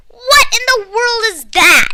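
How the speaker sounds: put-on girl screeching at a strange noise. A narrator's reading. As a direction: scream it over the grinding noise. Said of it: "I screeched at the top of my lungs".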